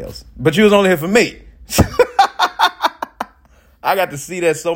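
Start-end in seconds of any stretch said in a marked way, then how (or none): 1.4-3.2 airy high laugh; breathy laugh. Real, not acted. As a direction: laugh without voice. Laugh at the joke.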